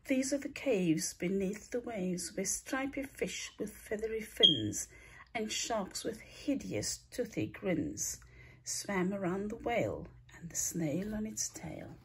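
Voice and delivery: ordinary delivery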